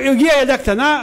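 ordinary delivery

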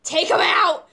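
loud, high demand; exasperated shout. Retold or imitated. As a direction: yell it at the doctor who didn't get it. Repeats demand to baffled doctor, louder.

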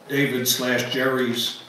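ordinary talk

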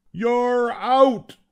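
loud, high-pitched umpire's ruling; umpire's shout. A narrator's reading. Umpire calling a player out, shouting. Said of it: "shouted the umpire".

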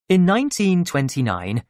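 usual speaking voice

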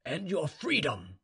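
measured, commanding master; general making an offer. A narrator's reading. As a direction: offer the reward slowly and deliberately. General offers the slave a reward.

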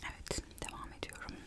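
ordinary talk, nothing marked

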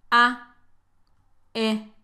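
"A" as an open a, "E" as a short reduced e; isolated vowels "A" and "E", pause between; two different vowel sounds. Shown as a demonstration. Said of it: "tienen pronunciación diferente. Escucha".

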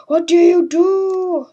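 loud, high, slow goofy creature voice; confused creature voice. Pretend. Gamer voicing the confused creature companion. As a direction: boom it out as a dopey monster.

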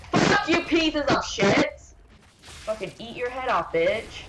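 real gamer fury, dragged out and loud; raging at the game. Real, not acted. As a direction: spit it at the enemies on screen.

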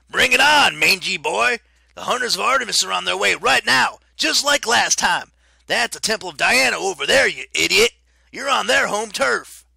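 high, loud taunting yell; yelling coach. A narrator's reading. Coach Hedge taunting Lycaon, yelling high and loud. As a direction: scream the challenge at him.

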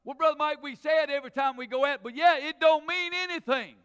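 loud, rising preacher's cadence; full-voiced sermon delivery; preaching at full force. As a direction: drive it home to the pews. Preaching to congregation.

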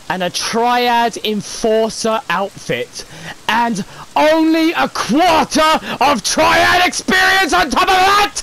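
ranting at a stingy reward; rising rant. Real, not acted. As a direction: work up into a yell.

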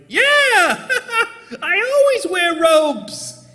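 squeaky defensive yell with a laugh; high defensive shout. Retold or imitated. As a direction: insist too loudly. His defensive reply.

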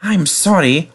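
ordinary delivery, nothing marked

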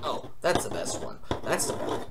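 soft, half-whispered mutter over a test; muttering to himself. Retold or imitated. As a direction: murmur it under your breath, pleased. Muttering answers during a test.

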